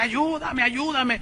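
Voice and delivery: mock cry for help, high and soft; half-voiced pleading wail; retold crying plea. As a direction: imitate a wailing woman without the volume. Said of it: "que lloraba y gritaba".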